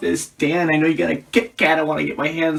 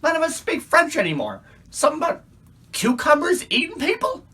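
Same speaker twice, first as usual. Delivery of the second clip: rising higher and higher in disbelief; incredulous mockery; 9 semitones higher than usual. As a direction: climb way up, baffled and rude. Weather girl mocks prime minister.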